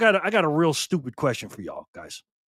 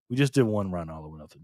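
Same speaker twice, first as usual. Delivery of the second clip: lower, worn-out repeat; tired repeat; 11 semitones lower, 6 dB quieter than usual. Real, not acted. Exhausted streamer repeating the announcement to chat, lower and tired. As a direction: say it again, drained and low.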